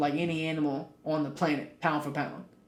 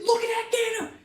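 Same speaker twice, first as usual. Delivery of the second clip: high excited yell at the gator; sudden high shout of alarm; retold yell. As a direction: call out in surprise to point out the gator. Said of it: "I screamed out".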